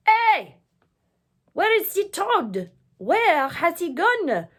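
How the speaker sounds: high, loud shout; crying out. Acted. The French mob shouts in confusion, high and loud. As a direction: yell in surprise. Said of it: "with their knives cried".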